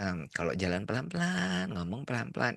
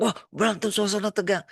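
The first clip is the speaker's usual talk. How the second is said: alarmed onlooker; 12 semitones higher, 6 dB louder than usual. Retold or imitated. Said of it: "Ada bilang".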